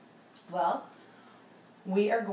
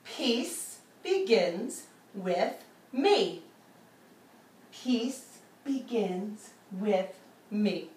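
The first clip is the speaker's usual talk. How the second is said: very slow chanted repetition; slow mantra; much slower than usual. Shown as a demonstration. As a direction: chant it slowly with the class. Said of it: "let's say it together".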